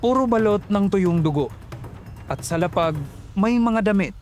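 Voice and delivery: usual speaking voice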